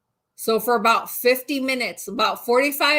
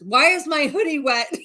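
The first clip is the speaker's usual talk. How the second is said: higher, louder, laughing through the quote; laughing imitation; 6 semitones higher, 5 dB louder than usual. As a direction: mimic him while cracking up. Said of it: "And here he's just like".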